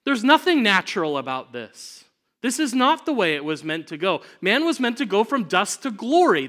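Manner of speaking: high, loud, impassioned rebuttal; thundering preacher's shout; screamed protest. Acted. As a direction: cry it out at the congregation as an outraged prophet. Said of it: "Moses would scream at those people".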